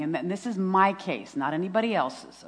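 ordinary talk

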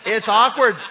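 loud, high laughing exclamation. Real, not acted. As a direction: laugh it out at the awkwardness. Preacher laughing at awkward scene.

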